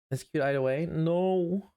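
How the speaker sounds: ordinary talk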